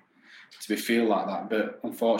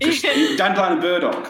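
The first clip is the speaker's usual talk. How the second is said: laughing; 9 semitones higher, 7 dB louder than usual. Real, not acted. Laughing recollection of teenage drink.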